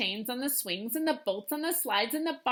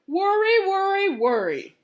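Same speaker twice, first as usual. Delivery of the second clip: grandmother; 7 semitones higher, 8 dB louder than usual. A narrator's reading. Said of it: "said her grandmother".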